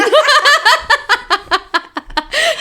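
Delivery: a sudden shrieking giggle; high squealing laugh. Real, not acted. Laughing hard at her friend's joke, high squeal of laughter.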